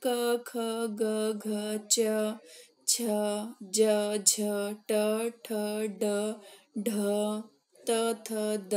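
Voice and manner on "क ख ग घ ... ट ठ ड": slow recital. Shown as a demonstration. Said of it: "जितने भी आपको अक्षर".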